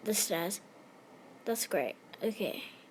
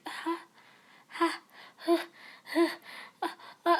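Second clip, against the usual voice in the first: confused noise; 6 semitones higher, 5 dB quieter than usual. Real, not acted. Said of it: "Sorry for that noise".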